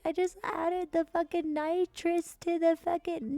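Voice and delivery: high put-on explanation; high imitated character voice; acted character quote. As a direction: voice his hurried excuse well above your pitch. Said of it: "He's like".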